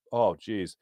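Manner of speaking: usual speaking voice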